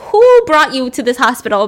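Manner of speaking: mock adoring nurse, loud and bright; gushing nurse. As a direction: sing his praises as the grateful staff. Nurses praising the new doctor.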